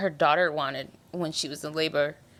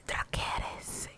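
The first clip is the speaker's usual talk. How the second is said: breathy whispered dragon command; 9 dB quieter, slower than usual. Retold or imitated. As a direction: whisper it like a deadly order. Quoting Aemon's command to his dragon.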